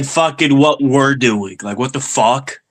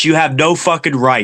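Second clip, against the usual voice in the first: usual speaking voice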